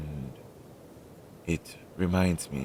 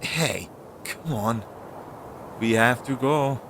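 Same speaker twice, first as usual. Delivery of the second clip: raised voice urging them to move on; calling out to hurry; 5 semitones higher than usual. Acted. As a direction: call out, impatient to get going. Urging companion to keep walking.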